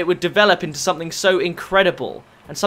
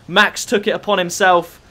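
normal talking voice

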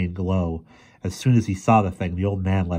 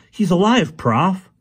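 put-on very high, loud urgent boy's voice; high loud boy's relief; excited boy; 12 semitones higher, 7 dB louder, slower than usual. A narrator's reading. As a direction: blurt out the relief. Boy finds friend alive.

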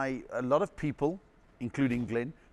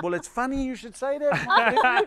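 laughing; 12 semitones higher, 7 dB louder, slower than usual. Real, not acted. Punchline, slow with laughter.

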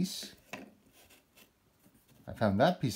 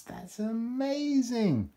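amazed praise; 11 semitones higher, slower than usual. Real, not acted. Praising a child's puzzle piece.

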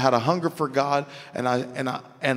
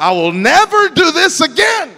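high, loud mock-desperate shout; hammed-up cry of resolve; shouted vow; 13 semitones higher, 9 dB louder than usual. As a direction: yell it like a dramatic oath. Acting out a vow after failing.

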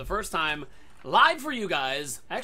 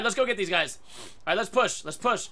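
normal talking voice

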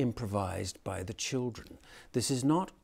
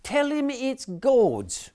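put-on much higher, louder child voices; children's nativity voices; 13 semitones higher, 7 dB louder, much slower than usual. A narrator's reading. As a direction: play the squabbling Mary and angel. Angel answers.

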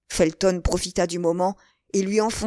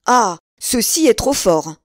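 louder, outraged exclamation; indignant cry; 3 semitones higher, 6 dB louder than usual. A narrator's reading. Buckingham, stepping to the door, cries out indignantly. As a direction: cry out in protest. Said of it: "cria Buckingham".

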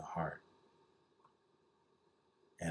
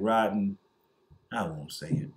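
ordinary talk, nothing marked

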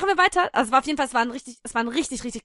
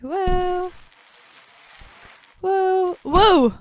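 high wows; 8 semitones higher, 4 dB louder, much slower than usual. Real, not acted. Wobbling exclamations during play.

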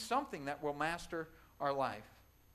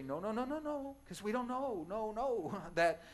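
parent warning; 4 semitones higher than usual. Retold or imitated. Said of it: "parents have to say".

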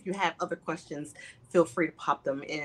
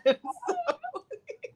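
high giggly laugh; high trailing laughter; real laugh. Real, not acted. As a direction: laugh fondly at a shared memory. Laughing over shared fond memory.

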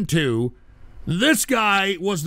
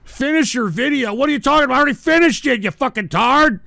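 loud, high exasperated yelling; furious ranting at the viewers; angry outburst; 7 semitones higher, 4 dB louder than usual. Real, not acted. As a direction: bark the questions at the chat in disbelief. Angry rant at chat.

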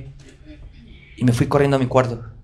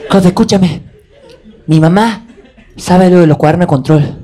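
higher, louder urgent warning; urgent friend; 4 semitones higher, 5 dB louder than usual. Retold or imitated. Classmate warns Job his mother knows, raised urgent voice. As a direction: sound the alarm to Job.

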